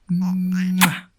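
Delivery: a big squeaky smooch; kiss for the baby. Acted. Villain dad showering his baby with kisses, high squeaky smooch.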